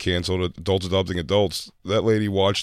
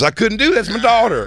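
mock protest; 12 semitones higher, 3 dB louder than usual. Speaks as imagined horrified father.

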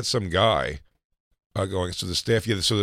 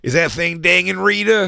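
high put-on holler; off-camera character; 9 semitones higher, 4 dB louder than usual. Host playing someone off camera asking Rita, put-on character voice. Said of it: "the girl who's off camera".

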